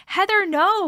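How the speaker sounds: normal talking voice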